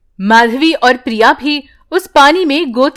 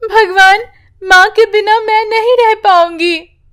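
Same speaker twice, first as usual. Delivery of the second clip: put-on higher child pleading to God; child's tearful prayer; 9 semitones higher than usual. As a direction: pray through tears as a little girl. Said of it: "यूं कहकर रोने लगती है".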